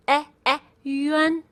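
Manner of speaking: e, e, then yuan with that same bed vowel. Shown as a demonstration. Teacher drilling the vowel for learners, yuan said yu-en. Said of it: "like bed".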